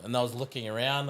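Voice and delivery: normal talking voice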